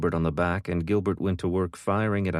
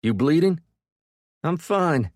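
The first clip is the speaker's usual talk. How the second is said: high, loud yell over the guns; yelling over gunfire; 11 semitones higher, 5 dB louder than usual. A narrator's reading. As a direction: shout over the fifty cal. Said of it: "Gilbert yelled".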